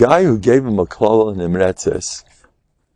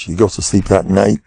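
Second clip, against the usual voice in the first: ordinary talk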